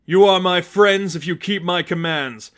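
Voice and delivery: loud, self-satisfied preaching; smug. Acted. Seraphim lectures Batman on loyalty, smug and loud. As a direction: lord it over them smugly. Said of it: "The Seraphim's voice was smug".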